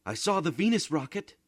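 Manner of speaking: put-on high, loud child's cry; excited child. A narrator's reading. Paolo telling the family he saw the rocket, high and excited. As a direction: blurt it out like an eager boy. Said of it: "cried Paolo".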